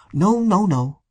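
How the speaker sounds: put-on demur gently, as to a child; velvety voice. A narrator's reading. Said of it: "speaking in a discreet, indulgent, velvety voice".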